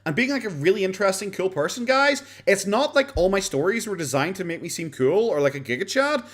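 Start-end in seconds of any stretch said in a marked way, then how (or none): 0.1-4.4 high, whiny imitation of Boogie; mock whiny defender. Streamer mocking Boogie's excuses, whiny high voice. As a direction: mimic a defensive streamer, pitched up.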